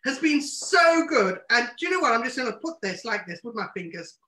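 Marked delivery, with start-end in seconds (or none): none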